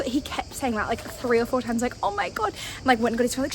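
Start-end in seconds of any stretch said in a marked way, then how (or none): 2.0-2.5 high mock dismay; pitying drunk. Drunk man repeatedly pitying a solo traveler, mock dismay. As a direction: imitate a drunk stranger's high-pitched pity. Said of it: "he was fucked up".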